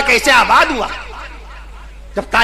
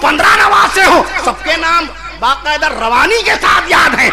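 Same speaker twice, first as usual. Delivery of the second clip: raised, impassioned sermon voice; fiery preaching; 5 semitones higher than usual. Acted. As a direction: preach it hard to the crowd.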